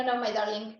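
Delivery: normal talking voice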